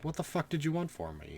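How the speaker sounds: exasperated retort. Retold or imitated. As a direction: throw up your hands at her. Retorting to the upset girl.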